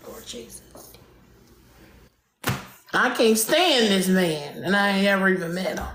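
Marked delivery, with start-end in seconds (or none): 0.1-0.6 whispered curse. Real, not acted. Curse muttered under breath.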